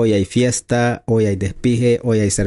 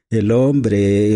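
ordinary talk, nothing marked

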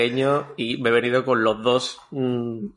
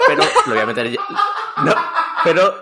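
riendo; 7 semitones higher, 4 dB louder, faster than usual. Real, not acted. Laughs changing topic.

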